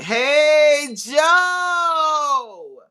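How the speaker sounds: loud high call across the house. Real, not acted. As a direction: shout his name to bring him over. Calling out to partner.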